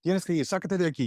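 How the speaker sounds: high, fast scolding in Spanish; a hammed-up novela mother, pitched high and rushed; Telemundo novela mom. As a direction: play a melodramatic soap-opera mother chewing out her kid. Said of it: "It was very Telemundo novela".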